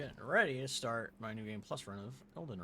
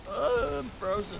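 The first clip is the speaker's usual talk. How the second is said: surprised; 11 semitones higher, 9 dB louder, slower than usual. Real, not acted. Game freezes mid-run.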